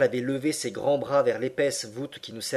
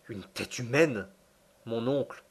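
stupefied reply; 4 dB quieter than usual. A narrator's reading. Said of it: "répondis-je non moins stupéfait".

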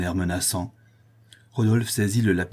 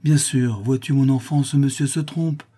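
desperate reassurance; 6 semitones higher, 4 dB louder than usual. A narrator's reading. Father desperately reassures his daughter.

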